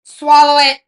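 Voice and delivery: loud joking bark; mock shout. Friend yelling a joke order at puking kid, loud. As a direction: yell it as a joke.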